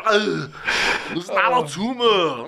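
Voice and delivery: slow, drawn-out Austrian action star; Arnold impression. Podcast host quoting Kindergarten Cop, slow Arnold voice. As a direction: stretch it out like Arnold.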